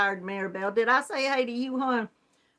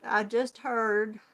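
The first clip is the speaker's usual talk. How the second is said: ordinary delivery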